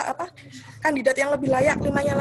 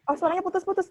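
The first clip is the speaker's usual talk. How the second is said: usual speaking voice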